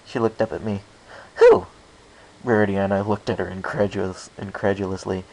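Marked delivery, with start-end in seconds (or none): 1.4-1.7 very high, loud, startled squeak; baffled who. A narrator's reading. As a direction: ask in total surprise. Pinkie baffled by the question.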